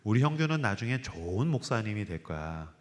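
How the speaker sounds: quietly insist, like a prayer; mother's tender words. Retold or imitated. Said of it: "저한테 이렇게 얘기하셨어요". (확신을 담아 조용히 되풀이하는 어머니의 목소리)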